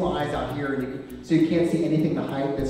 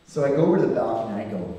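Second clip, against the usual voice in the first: ordinary talk, nothing marked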